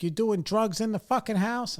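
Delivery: high, sharp accusing dad voice; angry dad. Retold or imitated. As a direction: play the furious father catching his son. Dad confronts son, retold.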